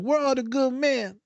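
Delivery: high, drawn-out mock lament; lamenting clients. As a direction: whine it high and slow as an imitation. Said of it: "they're like".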